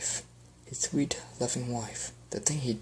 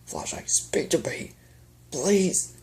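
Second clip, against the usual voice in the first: desperate plea; 6 semitones higher, slower than usual. Acted. Begging his dying wife.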